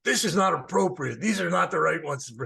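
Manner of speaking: high, loud indignant scolding; chewing out. Retold or imitated. Gruff ashram overseer rejects the flowers, chewing out. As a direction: snap a mock reprimand at the wrong flowers. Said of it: "chewing me out".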